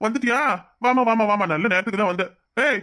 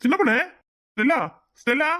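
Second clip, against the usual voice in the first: sharp call; 7 semitones higher than usual. Acted. Husband scolds wife sharply.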